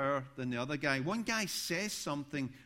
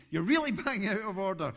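loud and higher, with a laugh through "really"; louder, more enthusiastic telling-off; 5 semitones higher, 7 dB louder than usual. Retold or imitated. Preacher re-enacting his bolder rebuke to the heckler, loud and laughing. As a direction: push harder, louder, laughing as you scold. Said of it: "you're getting a bit more enthusiasm".

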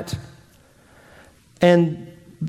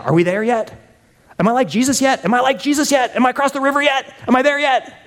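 put-on high whiny child asking again and again; impatient kid; 8 semitones higher, faster than usual. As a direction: whine like a kid in the back seat. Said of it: "Kids, kids, sadly, are selfish".

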